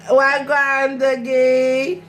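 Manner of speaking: loud, high call of Wagwan across the room; shouted greeting. Real, not acted. Greeting her friend Dougie from afar, loud call.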